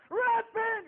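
sudden high, loud single cry; loud, high shouted repent; yell. Retold or imitated. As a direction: throw the word out hard. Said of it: "there, you do yell".

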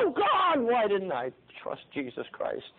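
high wail of regret, falling away; crying out. Retold or imitated. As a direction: cry up to God, then slowly sink. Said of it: "you'll cry out".

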